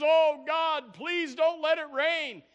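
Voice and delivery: high, loud, slow desperate plea to God; pleading prayer. Acted. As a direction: beg God hard, dragging the words. Preacher acts Elijah's pleading prayer.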